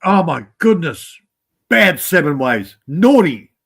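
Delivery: slow, loud playful telling-off; tut-tutting in fun, punching NAUGHTY; mock scolding. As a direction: scold them in jest, drawing the words out. Mock scolding of absent viewer.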